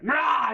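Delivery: high, loud mock scream; mom's angry roar. Mom before stomping off to bed, mock exasperated scream. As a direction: imitate a wordless burst of motherly rage. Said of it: "my mom's just like".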